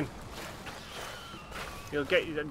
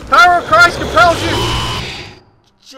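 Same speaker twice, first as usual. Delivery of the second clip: high, very loud, slow mock exorcism; mock exorcist; 14 semitones higher, 16 dB louder, much slower than usual. Player shouting an exorcism at the monster in panic. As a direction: bellow it like a panicked priest.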